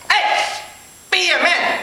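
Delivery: put-on high Chinese-accented goading. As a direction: goad the customer in a mock Chinese accent. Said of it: "he totally started telling me this thing".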